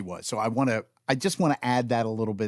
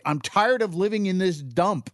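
imitated fed-up outburst, pitched higher; mock angry mother; 6 semitones higher than usual. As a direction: act out a mother throwing a fit. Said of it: "clench her jaw and she'd start throwing things".

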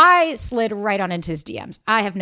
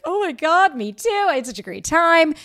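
much higher, bubbly overeager reply; gushing excitement; 7 semitones higher, 4 dB louder than usual. Retold or imitated. Answering his sweet text, gushing and overeager. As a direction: gush back like you're already in love.

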